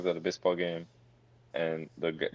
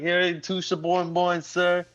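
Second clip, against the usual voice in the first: slow, much higher put-on vendor voice; mock vendor; 11 semitones higher, 3 dB louder, slower than usual. Vendor serving a laughing customer, hammed-up high call. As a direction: imitate a vendor calling as he hands over food. Acted vendor delivering the hot dogs.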